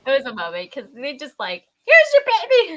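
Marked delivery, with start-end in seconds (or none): 1.9-2.7 high, loud announcing cry; exaggerated cheery proclamation; excited baby presentation. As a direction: sing it out like a midwife handing over a newborn. Voicing staff presenting the baby.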